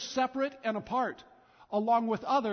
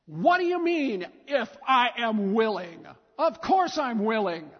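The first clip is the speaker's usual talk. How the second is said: indignant Jesus; 6 dB louder than usual. Acted. Jesus answering the leper's plea, indignant and loud. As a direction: push back hard, offended at the doubt. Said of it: "But what if it went like this?".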